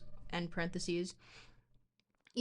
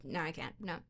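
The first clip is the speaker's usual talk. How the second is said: normal talking voice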